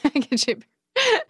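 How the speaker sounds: Southern dialect. Shown as a demonstration. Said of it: "say to me".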